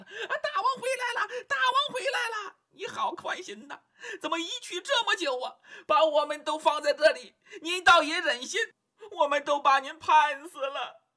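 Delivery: very high, laughing monkey chatter; monkey crowd squealing half-laughing, half-reproachful to the king; excited monkeys. Acted. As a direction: squeal and scold like overjoyed monkeys. Monkeys greet returning king.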